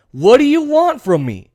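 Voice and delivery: insistent plea. Acted. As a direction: plead with them at full voice. Acting out Biden pressing Republicans.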